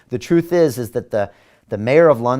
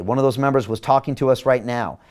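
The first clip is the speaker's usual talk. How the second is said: ordinary delivery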